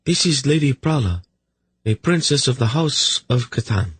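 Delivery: high-pitched royal lady's voice; queen mother. Acted. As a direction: introduce a princess as an old queen would. Queen Mother makes introduction.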